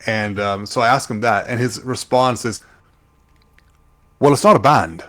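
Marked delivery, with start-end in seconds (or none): 4.2-5.0 loud, higher mock-posh British accent; posh British imitation. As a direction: correct them like a posh British newsreader. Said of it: "That does sound posh".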